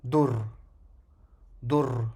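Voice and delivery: DUR ending on a bare trilled R; vowelless final letter. Shown as a demonstration. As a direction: land on the R of DUR and stop. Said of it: "ra, soukoun".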